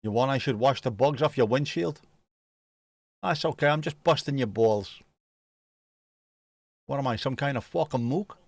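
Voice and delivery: put-on New York wise-guy patter; Brooklyn accent. Streamer joking about busting your balls, mock Brooklyn accent. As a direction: play it as a mock Brooklyn tough. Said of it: "get away with it in Brooklyn".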